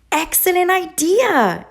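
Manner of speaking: high excited cry; exclaiming. A narrator's reading. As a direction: burst out with delight. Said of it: "exclaimed Duck".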